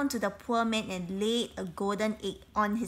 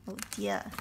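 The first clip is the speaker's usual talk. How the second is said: mock dismay; 13 semitones lower, 4 dB quieter than usual. A narrator's reading. Narrator reacts to capture.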